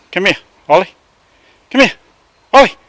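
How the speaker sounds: loud, high call, repeated; calling the dog. Real, not acted. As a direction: call him back firmly. Calling the excited dog over.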